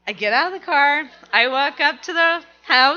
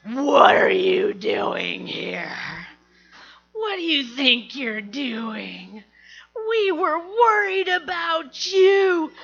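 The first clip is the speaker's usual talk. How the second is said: mock scolding dad; 3 semitones higher, slower than usual. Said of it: "immediately he goes".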